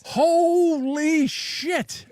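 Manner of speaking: high, drawn-out amazed exclamation; real astonishment. Real, not acted. Host hears the fundraiser total, real astonishment. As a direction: react stunned, stretching the first words out high.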